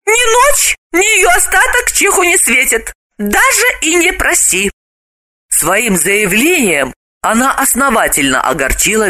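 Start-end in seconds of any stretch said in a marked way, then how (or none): 0.1-4.7 very high, fast, clipped woman's voice; high indignant woman refusing; sharp refusal. Acted. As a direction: cut him off flatly in a high voice. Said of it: "Галина отрезала".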